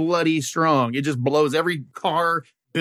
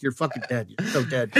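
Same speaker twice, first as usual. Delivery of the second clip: softer, deadly serious threat; low mock threat; 3 semitones lower, 6 dB quieter than usual. Mary Jane quietly threatening Peter after the fart joke, softer. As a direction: promise his doom, quietly.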